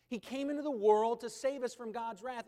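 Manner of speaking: loud, ringing pulpit statement; forceful preaching. Acted. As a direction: proclaim it to the congregation.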